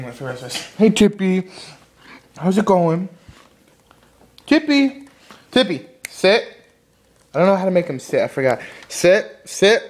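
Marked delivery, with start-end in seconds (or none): 4.5-5.1 high call of the dog's name. Real, not acted. Calling the dog. 5.5-6.6 dog command. Real, not acted.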